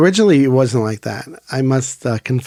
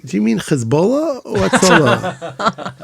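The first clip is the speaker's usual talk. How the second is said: laughing joke; 6 semitones higher, 3 dB louder, slower than usual. Retold or imitated. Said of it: "I was, like, in a good mood".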